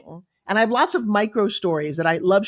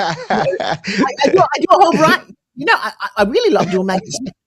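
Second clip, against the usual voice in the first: louder, showy British accent; 4 semitones higher, 5 dB louder than usual. Shown as a demonstration. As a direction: show off your British accent with relish. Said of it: "That's a good British accent".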